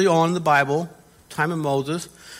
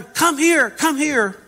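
higher, urging call to come over; acted beckoning call; 7 semitones higher, slower than usual. Preacher imitating lepers calling Jesus to them, beckoning call. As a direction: call someone over, pitched up. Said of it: "No, they're".

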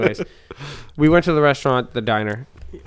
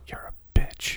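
put-on hushed kid insult; whispered insult; 5 dB quieter, much slower than usual. A nine-year-old to his brother, whispered.

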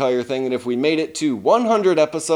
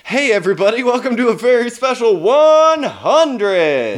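announcer voice; 8 semitones higher, 5 dB louder than usual. Acted. Hammy podcast intro announcement.

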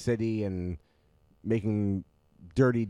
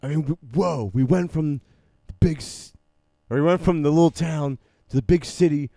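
Keanu Reeves impression; 5 semitones higher, 6 dB louder than usual. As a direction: play it big and dopey like a surfer. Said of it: "what's that Keanu voice?".

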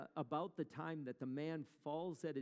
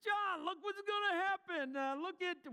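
hammed-up pig farmer, pitched way up and louder; a much higher, louder farmer egging his buddy on to watch; 6 dB louder, slower than usual. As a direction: call out like an excited farmer up on the hill. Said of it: "imagine those pig farmers going".

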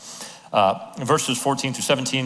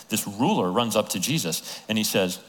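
normal talking voice

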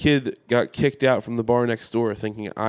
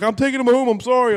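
mock friend apology; 12 semitones higher, 4 dB louder than usual. Friend's apology voiced high.

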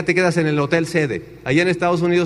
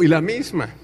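usual speaking voice

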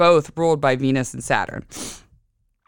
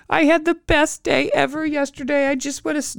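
high child voice, a hint of tears; lightly voiced crying child; 11 semitones higher than usual. Mother imitating her son crying in the bath. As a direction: mimic a sad little boy, pitched high. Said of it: "and he was like".

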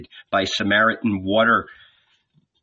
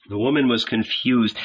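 usual speaking voice

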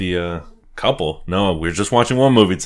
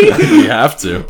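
laughing; 8 dB louder, much slower than usual. Real, not acted. Laughing high burst.

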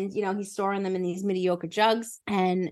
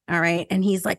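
ordinary delivery

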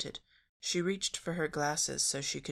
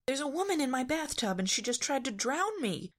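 high, indignant complaint; cross; 11 semitones higher than usual. A narrator's reading. Daphne telling Fred she was nearly drowned, cross. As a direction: complain crossly, pitch well up. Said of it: "Daphne said crossly".

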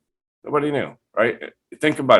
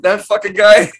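high, louder incredulous echo; jealous disbelief; 11 semitones higher, 6 dB louder than usual. Retold or imitated. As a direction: squeak it out, outraged. Echoing host's jealous disbelief.

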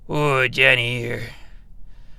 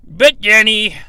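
dramatic outburst; 9 semitones higher, 10 dB louder than usual. Acted. Dramatic confession to host.

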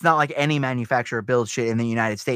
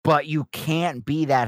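ordinary talk, nothing marked

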